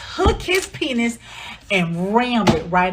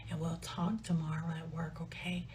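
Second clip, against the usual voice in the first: hushed whisper of a woman putting him off; 5 semitones lower, 14 dB quieter than usual. Retold or imitated. Lisa whispering to John to wait till work. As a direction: whisper it like nobody may overhear.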